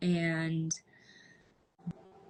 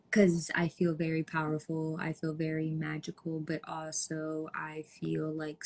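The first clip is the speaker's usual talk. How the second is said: ordinary talk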